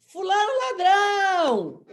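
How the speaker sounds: loud, high, stretched-out stadium jeer; grito de torcida. Acted. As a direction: yell it long like an angry fan. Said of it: "começa a gritar". (berrando como torcida)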